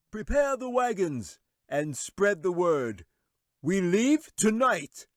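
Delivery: high, slow, loud command to the crowd; wizard's shout. A narrator's reading. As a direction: bellow it out slowly like a wizard in charge. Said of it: "the wizard said with a shout".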